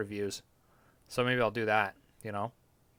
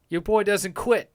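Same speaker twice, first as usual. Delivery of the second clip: higher, louder and slow; slow, loud boast; proud declaration; 7 semitones higher, 6 dB louder, slower than usual. Real, not acted. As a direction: announce it like a vow to the chat.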